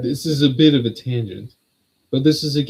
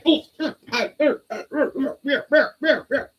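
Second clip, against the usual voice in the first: high laughing ahs. Real, not acted. Cat attacking, laughing cries.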